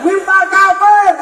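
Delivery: high, loud, slow taunt; mocking critics. Critics jeering at the preacher, high mocking jeer. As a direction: sneer like an online hater. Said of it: "saya dihujat".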